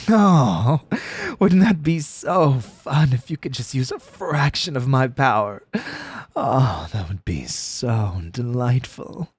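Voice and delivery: slow, silky, scheming god; tempting trickster god. Shown as a demonstration. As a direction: lure them in, slow and sweet. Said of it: "Something like that".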